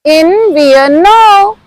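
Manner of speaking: "Invierno" drawn out slowly; slow model. Shown as a demonstration. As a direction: stretch "Invierno" syllable by syllable for learners. Teacher models word slowly for repetition.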